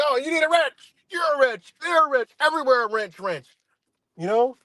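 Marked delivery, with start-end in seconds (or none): 0.0-3.5 high, singsong mockery of a huckster; mocking singsong. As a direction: lilt it like a carnival barker offering wrenches. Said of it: "It's like".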